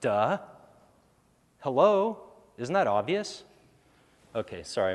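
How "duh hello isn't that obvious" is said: dripping sarcasm, pitched way up; mocking, exaggerated disbelief; sarcastic. Real, not acted. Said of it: "my sarcasm is coming out".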